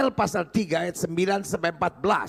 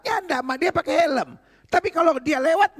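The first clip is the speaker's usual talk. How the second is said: put-on high-pitched boy pleading his case to mum; insistent mock-child explanation; protesting child, acted; 8 semitones higher than usual. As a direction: play the son protesting, pitched up. Boy explains himself to mother.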